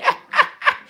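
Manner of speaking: short chuckle in quick bursts; amused laugh. Real, not acted. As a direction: laugh briefly at the mix-up. Amused by morning mix-up.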